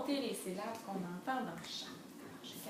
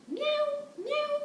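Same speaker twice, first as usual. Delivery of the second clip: very high mock mew; two squeaky playful meows; imitated cat meow; 5 dB louder than usual. Cat answers in story.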